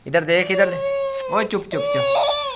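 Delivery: slow, high coaxing chup chup; slow sing-song shushing. Real, not acted. Hushing the crying baby.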